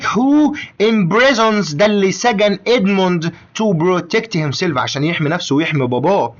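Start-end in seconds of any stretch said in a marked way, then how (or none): 0.0-1.8 each word said slowly and carefully; slow dictation. Shown as a demonstration. Teacher reading the English term slowly to Omar. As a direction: spell it out slowly for the student.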